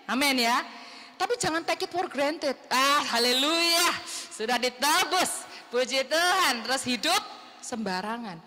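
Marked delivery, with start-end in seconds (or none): 2.7-6.6 drawn-out, mock-pious praise; mock carefree praise. Preacher mimicking believers who take redemption for granted, mock carefree praise.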